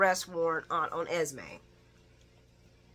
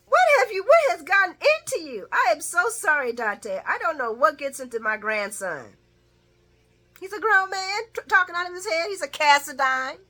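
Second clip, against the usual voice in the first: flustered grandma; 9 semitones higher, 5 dB louder than usual. Retold or imitated. Laura apologising for grandson.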